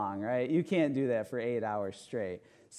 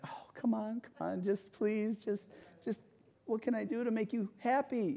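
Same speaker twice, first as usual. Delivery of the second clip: put-on higher, softer coaxing plea to a crying child; desperate pleading; 8 semitones higher, 4 dB quieter than usual. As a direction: beg the little boy gently to calm down.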